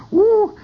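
high, loud "woo"; alarmed whoop. Retold or imitated. Waiting as the fuzz gets the message, alarmed whoop. Reacting to the cop overhearing.

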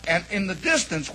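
normal talking voice

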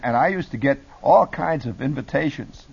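normal talking voice

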